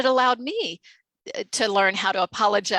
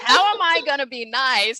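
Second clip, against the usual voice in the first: high, louder laughing worry; laughing nervously; 5 semitones higher, 6 dB louder than usual. Retold or imitated. Telling the host about the dreaded call, nervous laughter.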